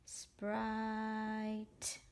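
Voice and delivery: "sprite" with a long held I and separate final t; slow sounding-out. Shown as a demonstration. Teacher modeling reading the word, "sprite" drawn out. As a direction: stretch "sprite" slowly on a steady tone. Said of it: "when I read the word".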